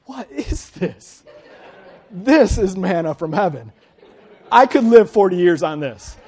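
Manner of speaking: bursting, laughing wonder at a new taste; amazed delight. Retold or imitated. Israelite tasting manna for the first time, loud amazed delight. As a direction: exclaim it like a miracle food just landed.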